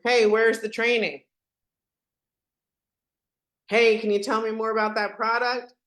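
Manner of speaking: normal talking voice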